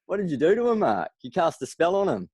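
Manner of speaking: amazed question. Retold or imitated. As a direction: tease him in high astonishment. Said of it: "we're just like".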